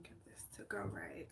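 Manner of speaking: whispered to herself while fiddling; barely voiced muttering under breath; whispering. Real, not acted. Muttering while fixing the camera.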